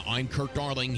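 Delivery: ordinary talk, nothing marked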